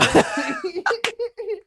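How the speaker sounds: big burst of laughter; loud laugh. Real, not acted. Laughs after losing his earbud.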